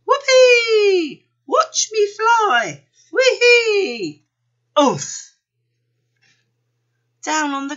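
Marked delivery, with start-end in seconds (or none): 0.1-4.3 very high, loud squeals of joy; gleeful whooping. A narrator's reading. Panda bounces up high, gleeful whooping. 4.8-5.4 loud, short grunt of impact; thudding oof. A narrator's reading. Panda crashes to the ground, loud oof.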